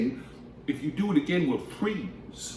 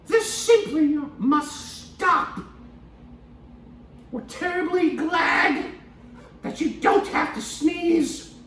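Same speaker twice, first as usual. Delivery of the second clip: mouse shouting; 4 dB louder, much slower than usual. Acted. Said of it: "Then he sat up and shouted".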